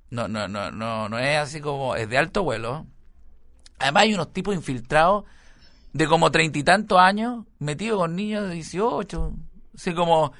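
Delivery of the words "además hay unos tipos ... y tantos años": usual speaking voice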